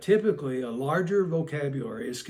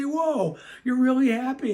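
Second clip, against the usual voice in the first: high, loud playful amazement; match a happy child with big put-on excitement; excited delight; 10 semitones higher, 5 dB louder than usual. Adult greets a child acting really happy, excited.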